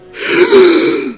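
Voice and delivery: sharp, high gasp; loud theatrical gasp. Acted. Narrator breaking off his tale, loud gasp. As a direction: gasp loudly for effect.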